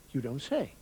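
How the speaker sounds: low English understatement; English reaction. Acted. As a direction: murmur polite English surprise. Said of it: "The English also use expressions like".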